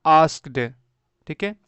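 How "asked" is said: "आस्क्ड" with half k and half s, one syllable; correct pronunciation. Shown as a demonstration. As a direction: say "आस्क्ड" firmly, no extra vowel. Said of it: "आधा क आएगा आधा स आएगा".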